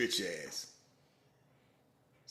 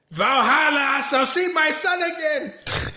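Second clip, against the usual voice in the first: high, loud, slow hammed-up battle cry; mock heroic cry; 16 dB louder, slower than usual. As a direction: proclaim it like a doomed Viking. Voicing warrior woman's battle cry.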